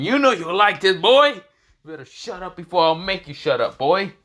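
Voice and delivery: loud, high, threatening bully; menacing thug voice. Acted. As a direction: bark threats, hammed up. Booty Warrior voiced threatening.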